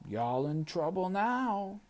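slow, high sing-song taunt; Gum Baby taunt. A narrator's reading. Gum Baby taunting the kids, slow and high. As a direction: drag it out like a cheeky little troublemaker.

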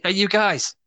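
high, laughing Scouse call; a Scouse kid calls to his mates, laughing; Scouse Goonies line. Pretend. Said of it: "the line that wasn't used in the movie".

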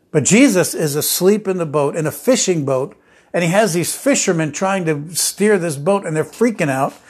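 ordinary talk

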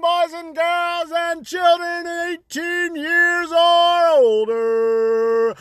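high, slow sung-out announcer intro; grand entrance announcement. Acted. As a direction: belt it like a ringmaster.

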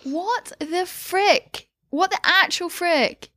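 high, slow outraged disbelief. Real, not acted. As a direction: stretch it out, utterly baffled. Disbelief at barbecue at school.

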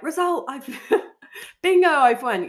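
high, loud giddy cheer; excited bingo win. Retold or imitated. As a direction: squeal it like you just won bingo.

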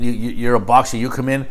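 ordinary talk

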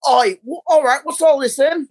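British bloke confronting someone, played for laughs; mock British accent. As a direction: put on a hammy British accent. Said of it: "the British accents are just so".

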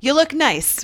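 high, loud fake-nice compliment; mock compliment. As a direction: gush it, then catch yourself. Mocking herself giving a compliment.

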